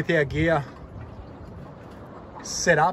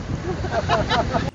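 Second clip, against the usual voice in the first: high breathy giggle between takes; 8 semitones higher than usual. Real, not acted. Mate cracking up between retakes of the line, giggle. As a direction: break into a giggle mid-take.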